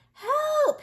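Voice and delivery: a very high, loud scream for help. A narrator's reading. Wolf tricking them again, screaming for help. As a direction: scream it at the pond. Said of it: "he screamed".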